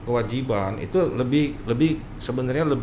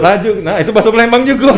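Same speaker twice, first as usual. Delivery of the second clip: laughing; 8 semitones higher, 11 dB louder, faster than usual. Real, not acted. Laughing at dialect joke.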